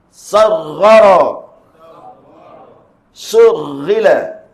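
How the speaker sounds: slow, loud drill, Maghfiratin and Sarghara with held thick ghain; model recitation. Shown as a demonstration. Teacher recites for learners to repeat, slow drill chant. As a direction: chant Maghfiratin, Ughriqu, Surghila slowly, pausing after each. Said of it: "Kita tengok iaitu bacaan dia".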